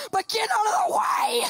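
high shouted order; hammed-up yell bursting out of politeness; scream at people. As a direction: suddenly holler to clear a path. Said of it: "as I scream at people".

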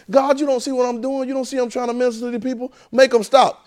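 high, pleading voice to God; frustrated minister crying out. Acted. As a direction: beg God to make it stop. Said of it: "He say".

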